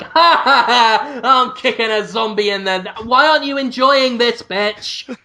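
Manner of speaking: high cackling laugh into playful jeer; mock gleeful taunt. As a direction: act out manic zombie-kicking glee. Acting own gleeful zombie killing.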